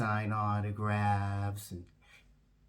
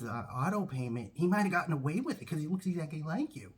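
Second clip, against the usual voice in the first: pitched-up gushing relief; gushing bank teller; 8 semitones higher than usual. Retold or imitated. Bank staff greeting the real celebrity, high gushing.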